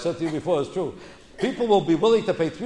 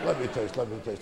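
low, hushed mock-connoisseur voice; mock wine taster; 5 semitones lower, 7 dB quieter than usual. Comic imitating a man impressing his girlfriend, hushed mock taster. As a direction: play a fussy taster, softly and low.

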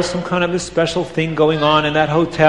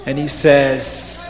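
ordinary delivery

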